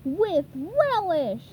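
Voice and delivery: high, loud, slow gleeful shout; excited cry. A narrator's reading. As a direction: yell it with joy as you fire. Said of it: "SpongeBob cried".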